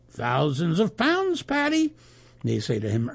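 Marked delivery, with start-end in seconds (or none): 0.1-1.9 slow, coaxing, climbing high at the end; urging. A narrator's reading. They tempt Paddy with money, urging him on. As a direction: dangle the money temptingly, drawn out. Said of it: "urging him on".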